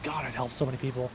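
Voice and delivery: hushed, low and moved; getting emotional. Real, not acted. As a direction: say it softly, touched by the thought. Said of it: "I'm getting emotional thinking about it".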